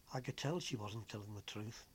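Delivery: ordinary delivery, nothing marked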